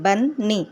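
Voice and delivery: बन्नी broken into बन, नी; split syllables. Shown as a demonstration. Teacher showing bunny has two syllables, split syllables. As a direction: say बन्नी as two separate beats, बन then नी. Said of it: "बनी में टू syllables हैं".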